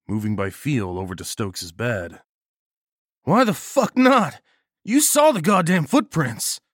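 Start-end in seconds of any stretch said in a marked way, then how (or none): 3.2-6.6 loud, high, furious outburst; angry swearing. A narrator's reading. Balmer swearing at the narrator in the dark, loud and furious. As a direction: spit the curse at him, then shout the rest. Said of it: "Balmer swore".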